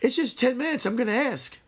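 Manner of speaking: high, breezy coworker voice. Acted. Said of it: "You say".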